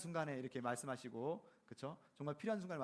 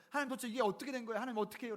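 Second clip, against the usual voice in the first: higher, fast exasperated plea to God; mock exasperated prayer; 7 semitones higher, 3 dB louder, faster than usual. Believer pouring out frustration to God, mock exasperated. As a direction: rattle it off like a fed-up believer. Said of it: "먼저 하나님 찾아가서".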